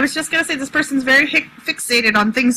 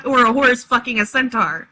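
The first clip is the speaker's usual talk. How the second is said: ordinary talk, nothing marked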